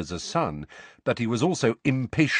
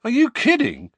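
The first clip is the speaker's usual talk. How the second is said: high, loud disbelief; astonished raised-pitch retort; incredulous; 7 dB louder than usual. A narrator's reading. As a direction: ask as if it's absurd. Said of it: "said Bill incredulously".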